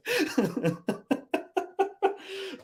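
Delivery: high-pitched helpless laughter; breathless giggling fit; real high laugh. Real, not acted. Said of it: "I'm laughing so much".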